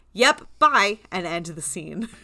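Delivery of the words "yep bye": high, loud, chirpy brush-off; abrupt cutoff. Retold or imitated. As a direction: cut him off cheerfully and loudly. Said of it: "the witches just go".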